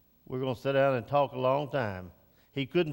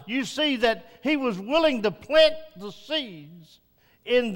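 rising, loud pulpit preaching, higher in pitch; full-force preaching; 9 semitones higher, 7 dB louder than usual. Acted. Pastor urging fathers at church, preaching at full force. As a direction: drive it home like a revival preacher.

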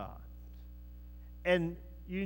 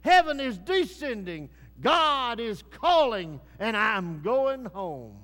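slow, louder, exalted proclamation; dying man's vision; 3 semitones higher, 7 dB louder than usual. Retold or imitated. D L Moody on his deathbed, slow and exalted. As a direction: proclaim it slowly like a dying saint seeing heaven. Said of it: "and he said".

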